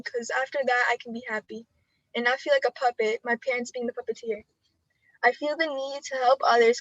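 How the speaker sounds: measured poetry-slam recitation; spoken word poem. Acted. Ninth grader reciting her coming-out poem to the audience, spoken word delivery. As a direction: deliver each line like a performed poem.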